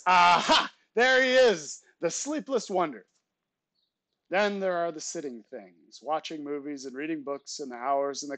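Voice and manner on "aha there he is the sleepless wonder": high, booming announcement easing off at the end; full-voice proclamation. A narrator's reading. Uncle greeting his sleepless nephew, proclaimed at full voice. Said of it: "he proclaims at full voice".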